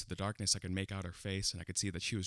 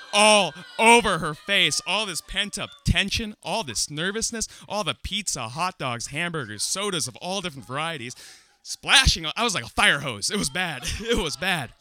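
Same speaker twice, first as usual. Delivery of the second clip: loud, high comic rant; hysterical comic horror; 9 semitones higher, 11 dB louder than usual. Acted. Comic relives vomiting on his crush, loud high outrage. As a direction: belt out each item in mounting disgust. Climax of vomiting story, ranting.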